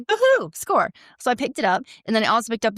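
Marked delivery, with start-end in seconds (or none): 0.0-0.9 high delighted whoop, mock-celebrating; excited whoop. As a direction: cheer the find like a prize. Retold excitement.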